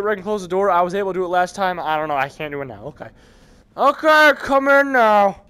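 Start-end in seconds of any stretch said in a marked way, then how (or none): 3.8-5.4 high, loud, slow drawn-out call; loud taunting call. Pretend. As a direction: call them in loudly, stretching the words. Taunts game enemies to come in.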